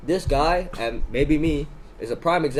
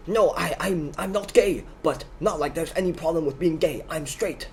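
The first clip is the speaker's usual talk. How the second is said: higher-pitched imitation of an offended man; mock an affronted stranger, pitched up and insistent; indignant protest; 5 semitones higher than usual. Stranger protesting about his personal space, indignant.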